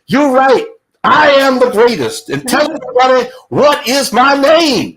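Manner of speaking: high, loud triumphant champ; Ali. Retold or imitated. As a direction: proclaim it grandly at full volume. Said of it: "Ali goes back and he goes".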